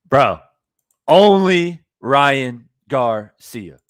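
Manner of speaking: loud, very slow, drawn-out words; exasperated disbelief. Real, not acted. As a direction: stretch out every word loudly, in disbelief. Exasperated after imitating the boxer.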